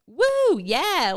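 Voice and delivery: high, loud party whoop; imitating a rowdy, elated cheer; excited cheer. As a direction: whoop it up like a celebrating fiancée. Acting out fiancée's cheer at proposal.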